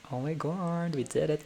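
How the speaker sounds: normal talking voice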